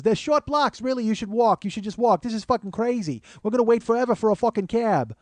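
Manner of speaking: high-pitched, irate New York nag; Fran Drescher-like nagging. Retold or imitated. Woman behind comedian urging him to walk, nagging. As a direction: imitate a pushy woman who won't let up. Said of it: "she's Fran Dreschering in my fucking ear".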